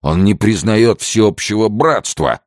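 put-on loud, high-pitched drunken indignation; indignant. A narrator's reading. Drunk man complains of Henry to his companion, indignant. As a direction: protest it as a wounded grievance. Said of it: "возмущался один".

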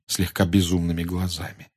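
plain reading voice

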